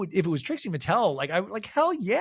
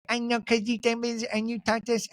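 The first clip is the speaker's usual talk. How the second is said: mock baby voice; 7 semitones higher than usual. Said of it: "Mary's like".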